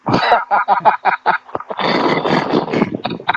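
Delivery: long, loud burst of laughter; real laugh. Real, not acted. Host cracking up at co-host's pronunciation jibe, loud laugh.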